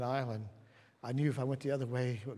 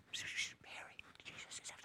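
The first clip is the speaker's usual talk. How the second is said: hushed, urgent shushing whisper; secretive whisper; 13 dB quieter than usual. Acted. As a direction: whisper it privately to a sister. Acting Martha secretly calling her sister.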